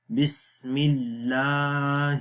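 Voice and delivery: slow بسم اللہ ending in a breathed 'hir'; haa sound. Shown as a demonstration. Teacher pointing out haa, slow بسم اللہ with clear h. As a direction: stretch 'laa' in اللہ, then sound the h. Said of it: "ہا کی آواز".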